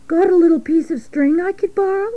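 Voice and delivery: high little pig voice; high-pitched, childlike request; asking piglet. Acted. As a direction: ask a favour in a high, hopeful voice. Piglet asks rat a favour.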